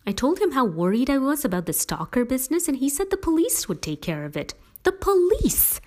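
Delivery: high-pitched exasperation building to disbelief; worked-up, pitch climbing as you go; indignant rant. A narrator's reading. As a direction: vent it, rising to an incredulous outburst. Stevie storms in, rants about Jareth.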